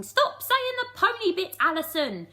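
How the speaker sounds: high, loud fairy yell; angry shout. Acted. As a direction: scream it at Alison.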